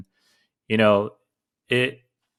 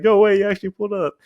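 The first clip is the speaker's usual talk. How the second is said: higher, fast, giddy disbelief; amazed disbelief; 11 semitones higher, faster than usual. Retold or imitated. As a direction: act stunned he really showed up. Friend amazed at the door.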